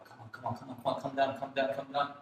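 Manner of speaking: softly urging the price down; hushed, repeated begging; urgent pleading. Acted. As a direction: plead under your breath, over and over.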